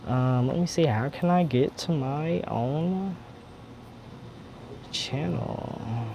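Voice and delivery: soft, distracted self-talk; talking to oneself. Real, not acted. Host hunting for own channel mid-livestream, talking softly to self. Muttering to herself while finding channel.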